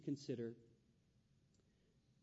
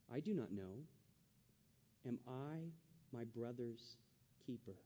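quiet evasive Cain; 4 semitones lower, 6 dB quieter than usual. Acted. Voicing Cain's evasive reply.

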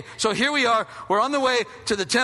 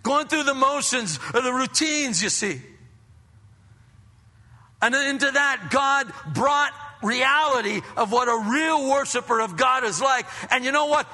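usual speaking voice

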